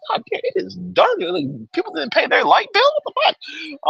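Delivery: high-pitched disbelief at the dark; incredulous. Retold or imitated. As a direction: squeak it out in shock, voice up high. Retold shocked reaction to darkness.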